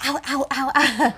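quick little mock yelps of pain; mock hot-pavement yelps. Girlfriend recalls walking on burning pool ground, mock pained yelps. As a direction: imitate people hopping on burning ground. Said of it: "everybody walking back to the pool was like".

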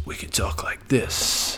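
slow, hushed mysterious whisper; sneaky whisper. Shown as a demonstration. Vlogger shows viewers a sneaky mysterious voice, whispered. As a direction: lean in and breathe it like a secret. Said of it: "you wanna get sneaky and mysterious".

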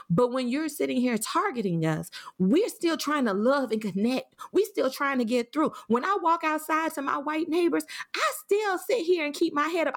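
pushing through, close to crying; emotional, near tears. Real, not acted. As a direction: voice rising high with emotion. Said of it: "I'm an emotional mess right now".

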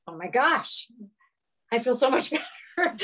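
breathless little laugh of relief, quickening; mildly amazed, feeling better already; relieved delight. Retold or imitated. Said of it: "and I was like".

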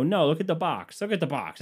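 high-pitched retold protest; exasperated insistence. Man arguing with partner about the trunk, mock exasperation.

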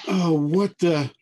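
slow, drawn-out groan of confusion; groggy moan. Acted. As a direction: moan it as if coming round. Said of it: "you hear kind of a moan".

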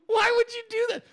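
high, wailing incredulous question; mock dismay. Host asking Leah why she filmed it, mock dismay. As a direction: squeal it in comic disbelief. Acting out his appalled reaction.

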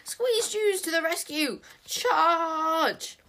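high shriek building to a long shout; shrieking battle cry. A narrator's reading. Little sprite diving to the rescue, shrieking. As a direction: shriek a drawn-out charge. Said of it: "shrieked at the top of his voice".

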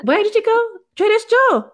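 put-on high, loud teasing take on a Filipino accent; mocking mom's accent. Teasing her mom about the store, hammed-up Filipino accent. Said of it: "I can make fun of their accent".

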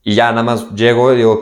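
ordinary talk, nothing marked

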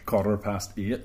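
slow Northern Irish accent. Shown as a demonstration. Showing co-host what to answer, slow Northern Irish accent. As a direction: model the Northern Irish phrase slowly. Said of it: "And then you say".

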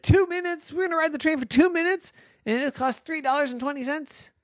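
high, fast outraged questions; indignant disbelief. Acted. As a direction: squeal in disbelief. Girls outraged at short ride.

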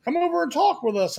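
dad coaxing his son out of the van. Retold or imitated. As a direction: lure the kid out of the van. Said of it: "he's like".

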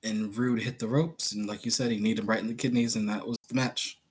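normal talking voice

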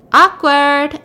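acted callous teasing; mocking awkward. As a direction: toss it out loudly, mocking bad news. Villain mocks news of death.

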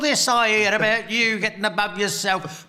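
hammed-up grandmother scolding with a laugh; scolding nan. Nan teasing her grandson for getting above himself, mock scolding. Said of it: "she went".

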